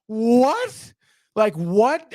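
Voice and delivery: high, stunned what; stunned disbelief. Real, not acted. Stunned reaction to the tip-in.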